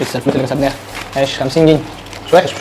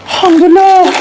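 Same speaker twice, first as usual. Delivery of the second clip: relieved cheer; 13 semitones higher, 4 dB louder, slower than usual. Real, not acted. Relief after a scratch win.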